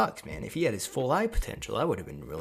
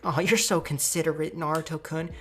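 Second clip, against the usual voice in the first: put-on higher-pitched, lightly swooning girl; fawning admirer; 6 semitones higher than usual. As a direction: gush sweetly at your crush. Fawning admirer voiced in story.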